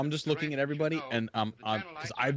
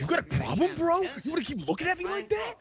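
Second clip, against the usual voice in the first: high, challenging tough-guy taunt; picking a fight with the staring skipper. Retold or imitated. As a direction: square up at the stern skipper. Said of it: "I would've been like".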